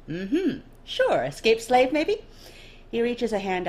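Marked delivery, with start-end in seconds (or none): 0.1-2.2 much higher, loud, sing-song; teasing nobleman. Acted. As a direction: needle her with a playful guess. Dorian teasing guess.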